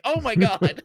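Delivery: loud exasperated groan of disbelief. Retold or imitated. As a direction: throw up your hands, loudly. Fed up with daughter's question.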